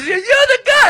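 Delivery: squealed, very high loud accusation; insistent girl. Retold or imitated. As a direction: mimic her shrieking that she knows him. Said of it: "and she kept going".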